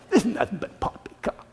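mocking laugh dismissing the preacher as a fool; laughing sneer. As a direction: scoff and laugh off "poppycock". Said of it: "some sneered".

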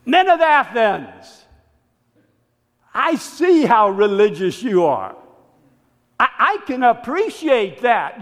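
respectful orator opening a speech; Paul's address. Acted. Paul addressing the Athenians.

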